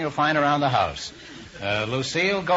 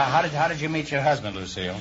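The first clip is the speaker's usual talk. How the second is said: ordinary talk, nothing marked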